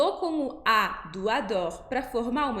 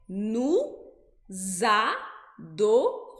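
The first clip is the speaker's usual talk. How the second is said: nous then a drawn-out adorons with the Z; slow liaison; 2 semitones lower, much slower than usual. Shown as a demonstration. Teacher slows nous adorons to show the Z. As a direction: stretch adorons slowly, Z leading it. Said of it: "É".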